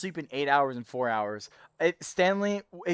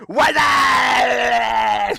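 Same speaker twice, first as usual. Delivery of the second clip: high, loud, stretched "What's up?"; shouted greeting; 8 semitones higher, 11 dB louder than usual. Shown as a demonstration. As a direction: belt out the greeting. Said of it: "I'll do my what's up again".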